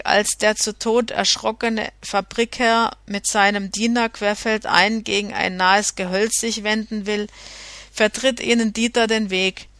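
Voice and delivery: plain reading voice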